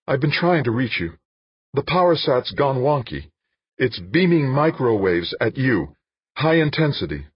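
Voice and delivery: high, loud rushed alarm; urgent warning over phone; frantic warning. Acted. Frantic caller warning senator.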